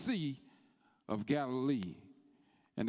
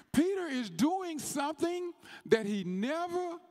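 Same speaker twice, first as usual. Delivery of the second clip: preaching at full force; 9 semitones higher than usual. Acted. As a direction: build the sermon into a shouting crescendo.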